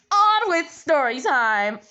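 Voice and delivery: drawn-out, slow announcer call; big, slow showy kickoff; playful announcement. Pretend. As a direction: herald the story, stretching the words. Announces the start of story time.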